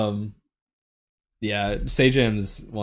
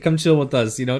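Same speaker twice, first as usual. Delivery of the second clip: normal talking voice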